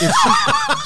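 high burst of laughter. Real, not acted. Laughing at his own story.

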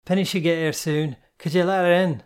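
calling out. A narrator's reading. Calling from down the stairs.

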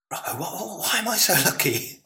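retold bemused wonder. Running back with Brian Robson, bemused wonder. Retelling his plea to a teammate.

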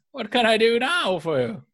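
put-on high, sweet old lady voice; elderly singsong. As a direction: coo it like a kindly granny. GM voices old clerk Mallory.

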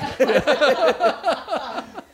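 high burst of laughter. Real, not acted. Laugh alone on the line.

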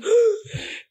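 sharp high gasp of a sob, voice jumping way up; a retold gasp while crying; sobbing gasp. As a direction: catch the breath like you're about to cry. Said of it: "me salí llorando así".

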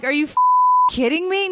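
high disbelieving squeal; incredulous. Retold or imitated. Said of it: "I'm like".

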